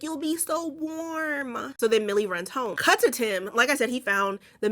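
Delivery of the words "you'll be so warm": high, slow and soft, eerily soothing; creepy soft preaching. Retold or imitated. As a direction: coo it like a cult member luring her in. Cult member preaching to Millie.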